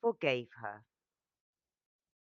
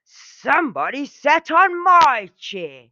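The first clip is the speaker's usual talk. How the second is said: loud, higher angry bear roar; 9 semitones higher, 11 dB louder than usual. A narrator's reading. Daddy Bear finds his chair used, roaring angrily. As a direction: bellow it like a furious father bear. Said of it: "Daddy Bear roared angrily".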